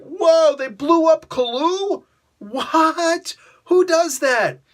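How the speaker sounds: high, loud, shocked exclaiming; mock shock. Critic acts a reader stunned by the twist, high and loud. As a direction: gasp at a plot twist. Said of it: "it's like".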